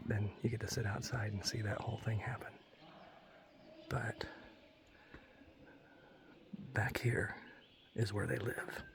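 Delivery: hushed whisper; whisper, pointing into the dark. Real, not acted. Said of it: "I have to whisper".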